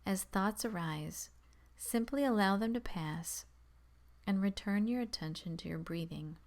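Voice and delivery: calm, measured meditation voice with long pauses; guided meditation. Acted. Guiding beginners to focus on the breath, calm meditation voice. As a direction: settle listeners gently, leaving space between instructions.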